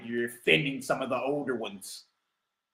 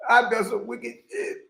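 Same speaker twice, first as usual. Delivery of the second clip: mimicking the clip; 6 semitones higher than usual. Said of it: "boy say".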